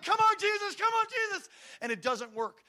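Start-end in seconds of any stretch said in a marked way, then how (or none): 0.0-1.5 crying out to Jesus much higher and louder; a desperate father at the window; shouted plea. Retold or imitated. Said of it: "I'm like".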